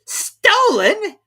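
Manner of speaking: very high, loud outburst; King exclaiming the hat is stolen. A narrator's reading. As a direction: leap to accusation. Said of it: "the king exclaimed".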